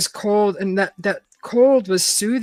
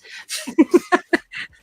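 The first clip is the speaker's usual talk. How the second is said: high airy giggle; breathy giggle; 9 semitones higher than usual. Real, not acted. Laughs at reading romance.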